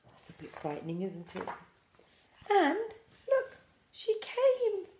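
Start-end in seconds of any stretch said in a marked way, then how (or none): 2.5-4.9 high excited reveal; bright storyteller surprise, high. Real, not acted. Showing children mum owl returning, high and excited.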